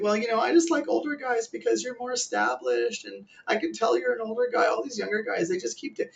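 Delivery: put-on sweet-talking club girl; girly voice. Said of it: "it's like".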